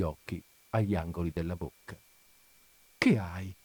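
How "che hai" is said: bewildered concern. A narrator's reading. Husband finding his wife in tears, subdued bewilderment. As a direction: ask it low, taken aback by the tears. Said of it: "stupito e confuso nel vedere che sua moglie piangeva".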